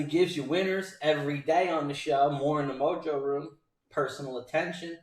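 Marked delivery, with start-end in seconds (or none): none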